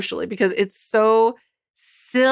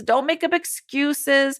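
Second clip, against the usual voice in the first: normal talking voice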